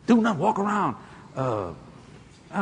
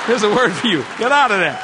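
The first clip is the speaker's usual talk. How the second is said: loud, higher comic command; raised-voice warning; mock stern order; 4 semitones higher, 7 dB louder than usual. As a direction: call out the punchline forcefully. Repeats comic command, called out.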